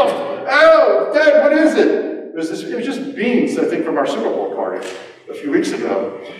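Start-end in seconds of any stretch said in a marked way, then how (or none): 0.0-1.9 much higher, louder, startled cry; mock alarm. Opening old beans from the fridge, mock alarm. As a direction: squeal it like a shocked kid. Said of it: "and I go".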